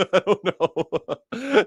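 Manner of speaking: slow and laughing; amused shrug while laughing. Real, not acted. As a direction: laugh it off, drawn out. Laughing at own brag.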